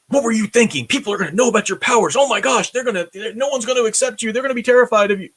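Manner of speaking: high, fast, alarmed telling-off; frantic worried father scolding his son; panicked scolding. Pretend. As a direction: scold him in a rush of parental panic. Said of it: "he doesn't scold Clark".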